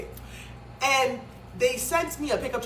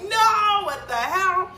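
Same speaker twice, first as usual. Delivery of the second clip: much higher, loud, terrified protest; panicked shriek; 10 semitones higher, 7 dB louder, much slower than usual. Retold or imitated. To the tow driver, as the car nears the cliff, shrieking in terror. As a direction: shriek it out in disbelief. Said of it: "I'm like".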